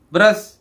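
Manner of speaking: "ब्रश" with a short open uh; correct uh vowel. Shown as a demonstration. Teacher correcting the example word, "ब्रश" with short uh. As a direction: say "ब्रश" with uh as in cup. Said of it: "ये ब्रश बोलते हैं इसको".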